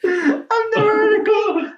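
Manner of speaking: real crying laughter; laughing squeal. Real, not acted. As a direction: squeal it while losing it. Laughing aside, high.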